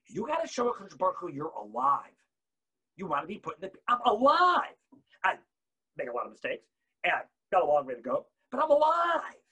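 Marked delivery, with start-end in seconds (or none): 3.9-4.8 higher, louder proclaiming; proud declaration. Real, not acted. Declaring being alive. 8.5-9.4 higher, louder proclaiming; proud declaration. Real, not acted. Declaring being alive.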